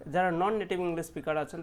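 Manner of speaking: ordinary delivery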